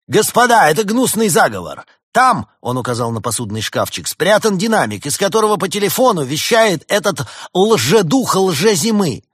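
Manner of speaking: loud, high outraged accusation; accusing shout. A narrator's reading. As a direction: denounce the plot at full voice. Doctor exposes conspiracy, shouting.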